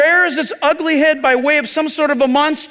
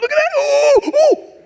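much higher, louder thrilled shout with whoops; giddy storm chaser; 5 dB louder than usual. Retold or imitated. Preacher mimics chasers goofing off in the storm, gleeful whoops. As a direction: whoop like a thrill-seeker in a hurricane. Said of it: "they're all happy and they're goofing off".

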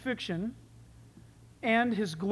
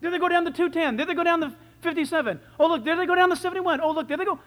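high, loud, rushing excitement; thrilled cheering; 6 semitones higher, 6 dB louder, faster than usual. Acted. Jesus watching his people go by, high loud cheering. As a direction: cheer them on like a proud fan. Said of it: "He's going".

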